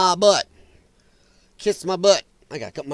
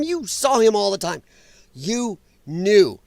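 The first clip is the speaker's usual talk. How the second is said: ordinary delivery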